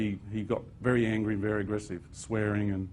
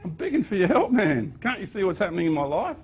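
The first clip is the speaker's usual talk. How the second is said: exasperated pleading; 8 semitones higher, 3 dB louder than usual. Retold or imitated. Pleading retold to archangel.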